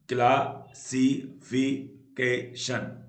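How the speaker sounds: Classification very slow, in five separate beats; syllable by syllable. Shown as a demonstration. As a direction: clear gaps between the syllables of classification. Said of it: "पांच syllable होते हैं".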